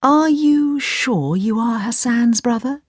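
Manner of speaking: slow, high questioning mother; doubtful. Acted. Mother doubts the stranger's story, doubtful. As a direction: ask it slowly with suspicion. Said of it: "Aladdin's mother frowned".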